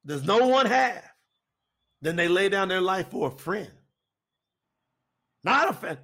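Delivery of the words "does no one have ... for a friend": ringing scripture quote that settles as it ends; proclaiming scripture. Acted. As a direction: proclaim the verse, pausing between phrases.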